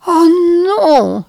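wife cries out on learning both glasses were poisoned; exclaimed. A narrator's reading. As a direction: gasp it out in dismay. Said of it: "s'exclama-t-elle".

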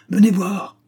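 high, loud cry to come and look; shouted call. Acted. Detective calls the others to the bed, loud shout. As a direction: call them over sharply. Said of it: "Ils entendirent un cri".

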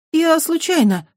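put-on high, loud embarrassed girl; sheepish apology. A narrator's reading. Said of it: "смущённо сказала Дукати". (смущённо)

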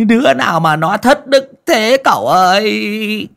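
high, loud, bawling lament; wailing sobs. Acted. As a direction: clutch his legs and wail for help. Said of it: "mếu máo mà khóc òa lên".

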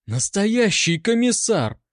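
high, heated retort; flaring up. A narrator's reading. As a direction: flare up in indignation. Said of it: "взвилась Анка".

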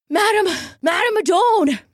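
high, breathless calling her name; gasping. Acted. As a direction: gasp it out after running. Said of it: "he gasped".

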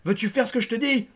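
high, loud exasperated shout; furious husband shouting at his wife; angry demand. A narrator's reading. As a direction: demand obedience at the top of your voice. Husband bellowing at wife in crisis.

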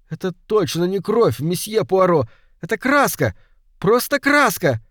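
put-on high, excited boyish cry. A narrator's reading. Boy finds the blood is only paint, excited cry. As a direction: call out the discovery, pitch jumping up. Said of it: "крикнул он".

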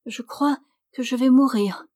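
plainte haute de mourante jouée; gémir comme une malade qui fait semblant; voix entrecoupée. Acted. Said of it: "une voix entrecoupée". (la suivante déguisée feint l'agonie, voix haute et hachée)

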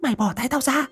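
very high, sharp irritated snap; snapping while choked. Acted. Mrs Vân choked by Dậu's grip, snapping at her. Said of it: "cho nên gắt".

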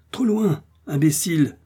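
high-pitched cry that drops on the insult; scornful exclamation. Acted. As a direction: call it out sharply, pitched well up. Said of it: "s'écria Joe". (exclamation moqueuse, voix haut perchée)

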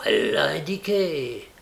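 usual speaking voice

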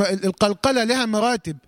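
normal talking voice

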